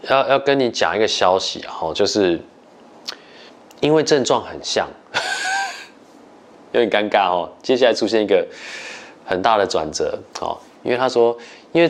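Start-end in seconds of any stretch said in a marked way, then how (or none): 5.1-5.8 nervous laugh. Real, not acted. Awkward laugh at misdiagnosis news.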